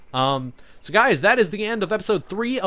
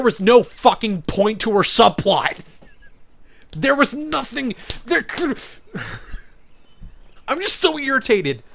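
exasperated rant; 5 semitones higher, 4 dB louder than usual. Real, not acted. Ranting about Kate's subplot.

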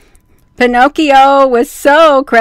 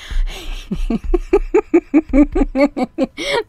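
high giggling laugh; helpless real giggle; 3 semitones higher, 6 dB quieter than usual. Real, not acted. Reader laughing at Pinocchio.

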